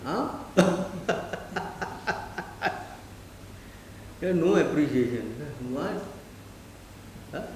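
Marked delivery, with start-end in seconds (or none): 0.4-3.1 drawn-out amused chuckling; long laugh. Real, not acted. Teacher amused by the complainer he imitated, long laugh.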